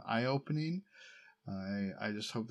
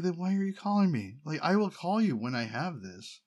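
louder and higher, fast exasperated rant at dad; 5 semitones higher, 4 dB louder than usual. Retold or imitated. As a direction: vent at him like he's called one time too many. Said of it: "I'm like".

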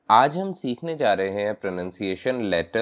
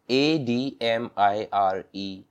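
"F I R M" spelled slowly, letter by letter; slow spelling; 2 semitones higher, much slower than usual. Shown as a demonstration. Teacher spelling for learners, slow letter by letter. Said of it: "admire".

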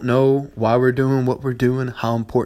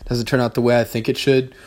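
normal talking voice

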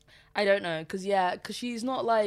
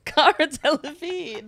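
high, loud and slow, still laughing; giggly dramatic announcement; 7 semitones higher, 9 dB louder, slower than usual. Real, not acted. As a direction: announce the name through laughter.

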